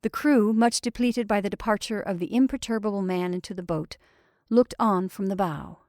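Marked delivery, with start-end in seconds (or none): none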